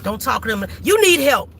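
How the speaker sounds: ordinary delivery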